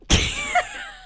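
helpless high-pitched giggle; burst of high laughter. Real, not acted. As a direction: crack up mid-call. Prank call breaking into laughter.

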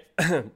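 sudden choking cough; coughing fit. Real, not acted. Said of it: "Excuse me, I'm choking on some".

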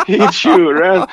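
high, loud mock yell; imitating yelling. Car salesman lying on the kerb, mock yelling for insurance. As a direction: cry it out like a panicked mimic. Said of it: "så började han bara skrika då".

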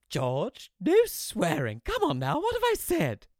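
high, loud, cheerful telling-off; gaily scolding mother. Acted. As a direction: scold him breezily. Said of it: "she carried on gaily".

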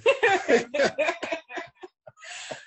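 high, rising burst of real laughter; amused laugh after joking about the same hospital. Real, not acted. Laughs at own hospital remark.

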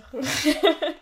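short nervous chuckle; a little nervous laugh. Real, not acted. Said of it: "c'est un rire nerveux".

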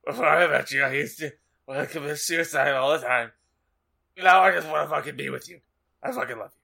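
put-on voice of a lovesick boy; hammed-up earnest love confession; Draco.

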